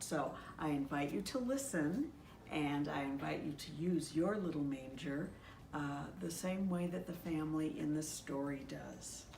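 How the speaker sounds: usual speaking voice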